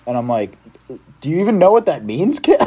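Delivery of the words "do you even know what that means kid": incredulous scolding. Retold or imitated. Counselor scolding kid.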